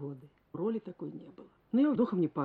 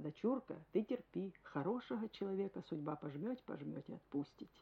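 father's gentle advice; 8 dB quieter than usual. Retold or imitated. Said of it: "Он мне говорил".

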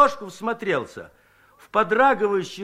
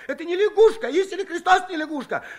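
much higher, laughing-edged frantic protest; frantic insisting; 11 semitones higher, faster than usual. Acted. Scared Shchukar swears to the women, high frantic protest. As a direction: swear it desperately. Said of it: "упорно выкрикивал".

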